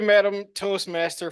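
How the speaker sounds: ordinary talk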